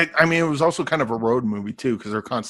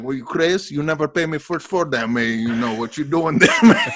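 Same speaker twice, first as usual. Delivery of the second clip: put-on higher, playful Afrikaner-accented protest breaking into laughter; mock Afrikaner accent; 4 semitones higher, 5 dB louder than usual. Co-host mimicking DiCaprio in Blood Diamond, mock Afrikaner accent. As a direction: imitate a weird Afrikaner accent, laughing at the end. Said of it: "a little tiny bit of".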